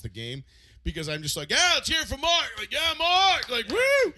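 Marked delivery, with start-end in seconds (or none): 1.5-4.1 loud, high fake cheering for the best man; mock hype. Covering a game outburst during the speech, loud mock cheer. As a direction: pretend the cheer was for Mark.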